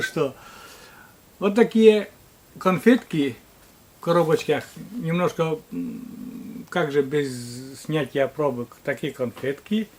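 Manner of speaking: normal talking voice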